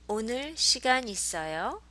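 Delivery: 있어요 swooping up at the end as a question; rising intonation. Shown as a demonstration. Teacher modelling a question for learners, 있어요 rising. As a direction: lift the end of 있어요 high, carefully enunciated. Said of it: "So with a rising intonation".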